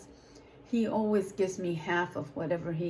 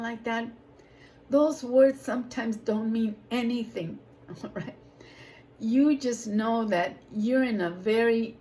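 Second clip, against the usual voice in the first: emphatic preaching; 2 semitones higher, 4 dB louder than usual. Acted. Preaching testimony to audience.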